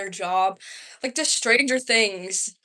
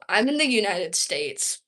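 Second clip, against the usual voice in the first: ordinary talk, nothing marked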